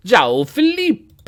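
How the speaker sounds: loud, high, drawn-out Italian-style announcement; mock Italian accent. Streamer announcing his new player, exaggerated Italian accent. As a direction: belt the name out slowly like an Italian. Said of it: "saying it like an Italian".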